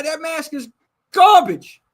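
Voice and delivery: Brooklyn accent, loud and high; New York accent. Real, not acted. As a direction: Brooklyn "garbage", punched hard. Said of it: "Sound like you're on Jersey Shore".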